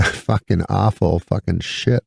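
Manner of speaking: ordinary talk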